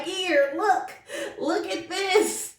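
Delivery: high, crying with joy, slowing on the last words; happy crying plea; tearful excitement. Retold or imitated. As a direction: push the tests at him, overcome. Showing husband the tests.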